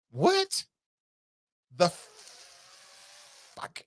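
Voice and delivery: a high gasping "What" then a whispered curse; floored, the words almost fail him; stunned disbelief. Real, not acted. Shocked reaction to the comment.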